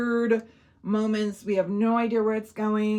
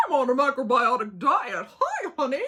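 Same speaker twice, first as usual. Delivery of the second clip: mock an irritating British man chatting her up; obnoxious British guy; 2 semitones higher than usual. British guy chats up his seatmate, obnoxious. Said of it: "this obnoxious British guy who's just like".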